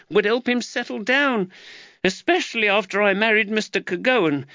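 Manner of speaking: mother laments her son to the constable; anguished mother. Acted. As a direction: pour out your worry. Giacchetta lamenting.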